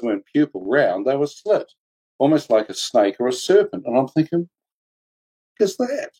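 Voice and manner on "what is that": startled question. Retold or imitated. Seeing the shadow beings.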